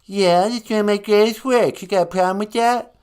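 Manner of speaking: hammed-up grumpy artist; mock angry Van Gogh. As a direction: fume at the host as a peeved painter.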